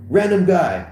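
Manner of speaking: usual speaking voice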